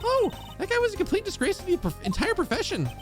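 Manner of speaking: high-pitched and indignant; squeaky outraged young woman; high girly voice. Acted. Same girl character, indignant.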